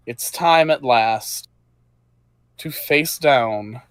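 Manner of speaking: slow, dropping, ominous build; ominous narration. A narrator's reading. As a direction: announce the final foe gravely. GM dramatic build to boss.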